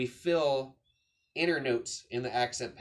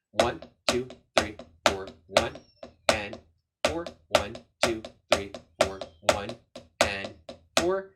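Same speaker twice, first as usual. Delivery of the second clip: counting to the beat; 3 semitones lower, 5 dB louder than usual. Shown as a demonstration. Drum teacher adding eighth note taps, loud rhythmic count. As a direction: call each accent out firmly on the beat.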